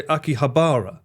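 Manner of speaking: Akihabara with a long, stressed BAR; others' pronunciation. Retold or imitated. Streamer quoting English speakers, Akihabara as aki-ha-BAA-ra. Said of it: "I've seen a lot of people".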